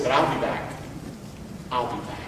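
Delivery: low and soft, fading away; hushed, understated resolve; quiet retold promise. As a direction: quote a subdued promise, sinking nearly to nothing. Friend's parting promise retold.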